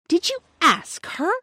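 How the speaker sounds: slow, high, scornful question. A narrator's reading. Sister scorns her brother for asking the servant, slow and high. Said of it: "said Roberta with scorn".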